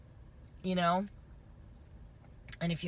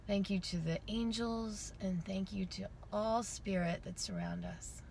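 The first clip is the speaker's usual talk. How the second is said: calm closing meditation; guided meditation; 5 dB quieter than usual. Acted. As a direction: guide them gently into stillness.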